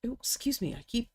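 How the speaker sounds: talking through hiccups; hiccupy, apologetic aside. Real, not acted. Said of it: "keep hiccuping".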